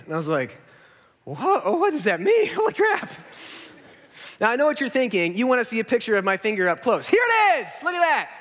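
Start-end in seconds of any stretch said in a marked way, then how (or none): 1.2-3.1 rising, high disbelief breaking into a laugh; shocked reaction. Retold or imitated. Patient hears the finger is too macerated, shocked. 7.1-8.3 spring the gross photo on them; mock shout reveal. Fake reveal of finger photo.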